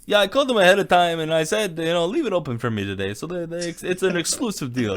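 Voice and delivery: high, loud mock Greek accent for Giannis. Podcast host imitating Giannis's interview in a Greek accent. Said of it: "in his Greek accent".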